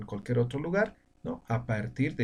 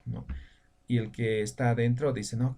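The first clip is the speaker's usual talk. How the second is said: usual speaking voice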